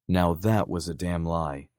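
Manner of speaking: ordinary delivery, nothing marked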